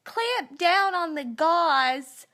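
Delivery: high, loud and slow, falsely pleasant; mock-friendly nurse. Nurse telling the patient to clamp the gauze, mock friendly. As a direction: make the order sound like a cheerful greeting. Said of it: "they say it in a tone that's almost like saying".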